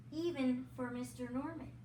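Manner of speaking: usual speaking voice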